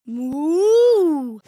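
long moo swelling in the middle; cow mooing. Pretend. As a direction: imitate a cow's drawn-out moo. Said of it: "What sound does a cow make?".

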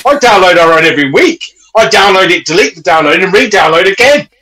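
high, loud, escalating boast; comic insistence. Acted. Confessing he downloads his own podcast, comic insistence. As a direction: insist it with mock zeal.

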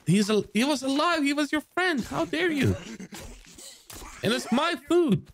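shrill, very high scandalised voice; mock outrage. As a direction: play a prissy offended bystander. Mock outrage at cannibals eating friend.

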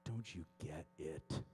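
deep, drawn-out divine voice; voice of God. Retold or imitated. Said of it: "He's like".

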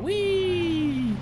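long, high, loud "wheee"; playful whee. Pretend. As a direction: squeal a drawn-out whee like on a ride. Playful cheer in the game.

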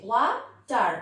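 ওয়া, short pause, টার; split in two syllables. Shown as a demonstration. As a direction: give ওয়া and টার a beat each. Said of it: "দুইবারে উচ্চারণ হয়েছে". (ওয়া টার, দুইবারে থেমে)

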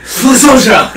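high laughing anime shout; anime battle cry. Pretend. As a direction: yell it like an anime hero. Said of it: "anime protagonist voice".

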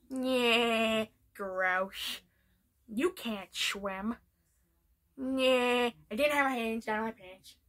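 a loose hint of Peter's dopey drawl; Peter Griffin. Fan doing Peter Griffin for viewers, lightly voiced. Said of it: "this is my impression of Peter Griffin".

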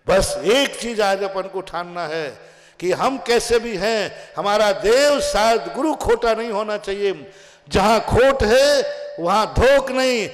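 forceful preaching. Real, not acted. Preacher laying down a rule for devotees, forceful. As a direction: drive the principle home, stretching the key phrases.

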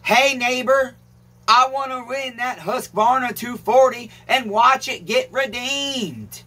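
high, slow and careful, word by word; exact wording. Shown as a demonstration. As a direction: spell out the comment slowly so they copy it. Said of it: "you have to say it exactly like this".

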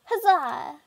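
high loud nerdy cheer; dweeby cheer. Pretend. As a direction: shout it like a dork. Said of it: "why did I say huzzah like that".

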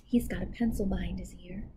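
hushed aside to the listener; quiet aside. Real, not acted. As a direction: drop to a hush, confiding. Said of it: "aside to listener".

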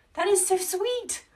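very high, loud gush of delight; delighted squeal. Real, not acted. Crafter thanking a viewer for a compliment, delighted squeal. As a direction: squeal it, genuinely touched by the praise.

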